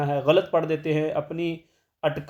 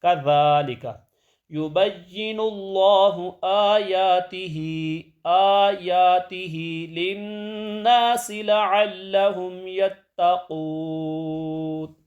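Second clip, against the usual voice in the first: very slow, sung tilawat, higher; Quran recitation; 4 semitones higher, much slower than usual. Shown as a demonstration. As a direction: draw out every vowel in chant. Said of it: "ایسے پڑھیں گے".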